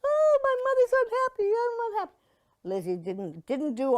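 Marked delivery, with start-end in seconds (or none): 0.0-2.1 put-on high, whimpering little girl; hammed-up crying child voice, much higher; mock whiny child. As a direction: whine like an insipid movie child. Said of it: "Little Mary is like".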